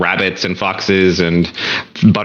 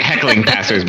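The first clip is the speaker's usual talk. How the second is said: ordinary delivery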